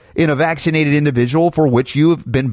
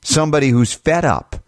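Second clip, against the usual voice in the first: ordinary delivery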